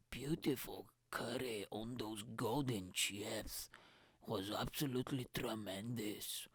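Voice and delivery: soft, slow, sensual food talk; savouring food. Acted. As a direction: murmur it like tasting each bite.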